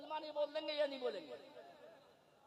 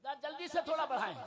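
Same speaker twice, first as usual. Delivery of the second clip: usual speaking voice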